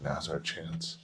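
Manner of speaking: hushed prankster tone; mischievous whisper. Retold or imitated. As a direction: plot it quietly to friends. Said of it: "he was like".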